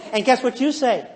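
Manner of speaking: usual speaking voice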